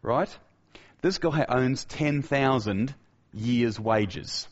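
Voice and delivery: ordinary talk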